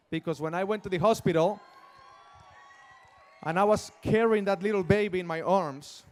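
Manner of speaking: loud, overcome with emotion; nearly shouting with feeling; emotional outburst. Real, not acted. Emotional memory of holding baby.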